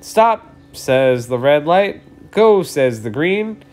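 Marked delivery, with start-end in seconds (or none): 0.0-0.5 high loud stop; firm command. Acted. Red light ordering traffic, loud firm stop. 2.3-2.6 higher, louder go; bright command. Acted. Green light waving traffic on, loud bright go.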